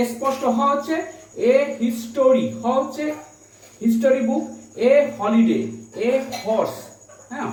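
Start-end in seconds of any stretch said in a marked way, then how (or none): none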